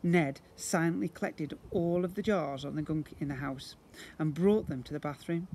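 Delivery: plain reading voice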